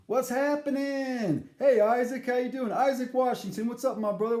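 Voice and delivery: high, loud, buddy-buddy hail; hyped greeting. Retold or imitated. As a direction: call out to the bartender like an old pal. Greeting Isaac, mimicking his voice.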